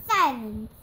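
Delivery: very loud one-word bellow; roar. A narrator's reading. Police chief silencing everyone, roaring. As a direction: roar the command. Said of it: "roared the chief".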